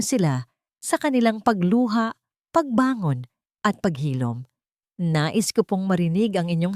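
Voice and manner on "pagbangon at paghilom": usual speaking voice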